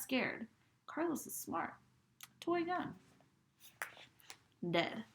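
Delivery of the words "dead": plain reading voice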